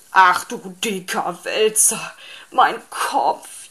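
a loud, wailing lament over his sore head. A narrator's reading. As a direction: moan it out like it really hurts. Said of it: "jammerte er". (laut jammernd über den schmerzenden Kopf)

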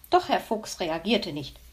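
ordinary delivery, nothing marked

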